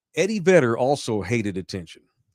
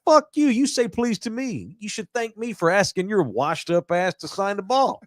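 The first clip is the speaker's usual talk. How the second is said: higher, outraged rant at the player; mock outrage; 8 semitones higher than usual. Imagined comeback to the ballplayer, mock outraged. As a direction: tell him off, mocking his words. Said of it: "That's what I would have told him".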